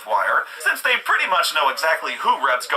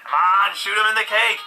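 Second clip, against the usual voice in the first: ordinary delivery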